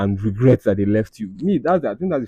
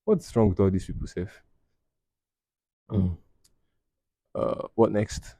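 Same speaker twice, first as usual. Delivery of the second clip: ordinary delivery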